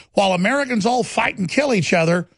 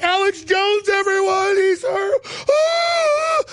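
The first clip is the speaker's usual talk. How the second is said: high shrieked mock alarm; 13 semitones higher, slower than usual. Mocking the crowd calling out his name, shrieking high. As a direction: scream it as a hammed-up panicked crowd. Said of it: "they were like".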